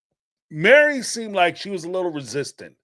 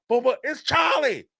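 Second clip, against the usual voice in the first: shouted reply; 9 semitones higher than usual. Retold or imitated. Grandson shouting back.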